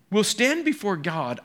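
ordinary delivery

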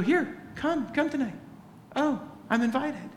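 light up at the invitation; delighted invite. Pretend. Eager invitation and realization.

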